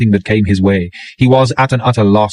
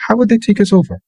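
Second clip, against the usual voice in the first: woman's voice; 12 semitones higher than usual. A narrator's reading. Female character voiced by narrator.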